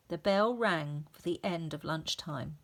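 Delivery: ordinary delivery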